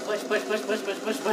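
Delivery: loud high chant of push; shouting push. Real, not acted. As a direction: urge it on, yelling. Shouting while pushing in game.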